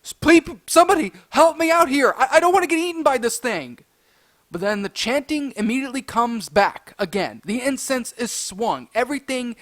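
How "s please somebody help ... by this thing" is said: fast, raised-pitch plea for help; retold panic, louder and stumbling; panicking. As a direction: beg for rescue in a rush. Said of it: "Now, I'm panicking".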